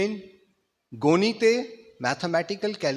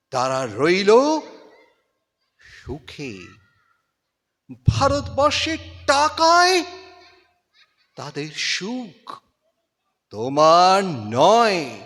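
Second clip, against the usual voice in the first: dramatic Bengali recitation, rising to loud peaks and dropping to near silence; impassioned recitation with swelling climaxes and hushed falls; poem recitation; 4 semitones higher, 6 dB louder than usual. Acted. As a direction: declaim it like a stage tribute to a fallen hero.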